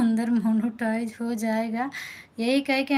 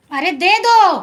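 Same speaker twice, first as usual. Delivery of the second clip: sharp raised shout across the room; loud call; 7 semitones higher, 13 dB louder than usual. Real, not acted. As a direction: yell for the notebook to be brought. Calling out to daughter for copy.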